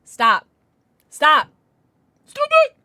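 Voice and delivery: ramp each stop up to a yell; building to a shout. Real, not acted.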